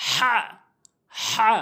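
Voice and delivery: হা with strong breath squeezed from mid-throat. Shown as a demonstration. Teacher modelling the Arabic letter, throaty হা. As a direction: push হা out from the middle of the throat. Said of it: "হলকের মাঝখান থেকে".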